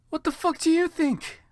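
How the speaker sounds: high, sharp angry retort. Acted. Heroine snapping at Bloody Mary, high and angry. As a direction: snap it back furiously. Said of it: "I asked angrily".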